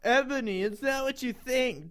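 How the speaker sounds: acted distressed call; mock sad scream. A narrator's reading. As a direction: cry it out in hammed-up sorrow. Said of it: "Draco screamed sadly".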